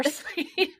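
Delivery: laughing through the name. Real, not acted. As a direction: giggle mid-phrase. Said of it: "I'm gonna laugh about that".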